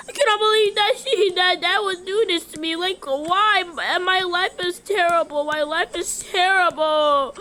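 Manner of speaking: high, hurried pretend whine; whining child. Punished child complains about the taken tablet, whining. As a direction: wail it like a hard-done-by kid.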